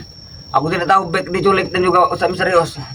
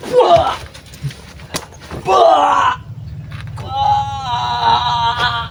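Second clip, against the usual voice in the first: cry of pain; 7 semitones higher, much slower than usual. Acted. Thug cries out in pain under attack. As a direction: cry out as it hurts.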